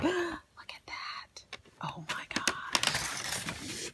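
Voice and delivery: hushed, drawn-out gasp of wonder; breath taken away, whispering; awed whisper. Real, not acted. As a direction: whisper it slowly in real amazement. Awed first sight of the wreath.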